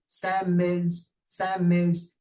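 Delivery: "Sam is" said as one word, "sam-iz"; linked M and I. Shown as a demonstration. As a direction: carry the M of "Sam" straight into "is". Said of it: "Eu juntei o M com o I". ("Sam is" emendado, juntando o M com o I)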